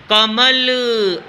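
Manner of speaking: "कमल" stretched very slowly, sound by sound; slow clear demo word. Shown as a demonstration. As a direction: spell it out slowly for the class. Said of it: "जैसे मैंने बोला". ("कमल" बहुत धीरे, एक-एक ध्वनि साफ़)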